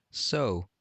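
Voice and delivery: normal talking voice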